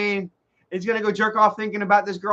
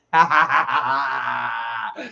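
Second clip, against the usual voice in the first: long, shouted laugh; 4 semitones lower than usual. Real, not acted. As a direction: burst into a drawn-out cackle. Laughs at his own rambling.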